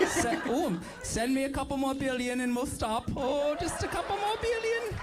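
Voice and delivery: put-on camp German-like accent. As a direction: wheedle in a mock Ukrainian accent. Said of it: "like a gayer German a little bit".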